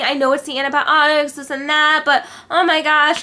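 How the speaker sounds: high, loud dramatic imitation; mock complaining girl. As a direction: overdo a fretting voice. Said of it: "they're, like, even saying".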